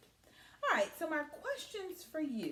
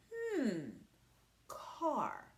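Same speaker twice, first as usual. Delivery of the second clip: ordinary talk, nothing marked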